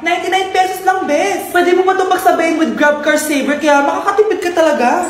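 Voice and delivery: upbeat sponsored pitch, loud; ad read. Acted. Friend pitches Grab saver fares to broke friend, loud ad read. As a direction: sell the Grab deal brightly.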